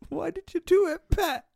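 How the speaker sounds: high, laughing put-on plea; hammed-up shocked plea through laughter; mock anguished question. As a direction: ham up a tearful TV interrogation. Mock pleading to staged victim.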